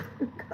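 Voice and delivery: a short wet laugh that catches; choked little laugh through crying; teary laugh. Real, not acted. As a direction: laugh as the tears come. Breaks down mid-sentence on camera.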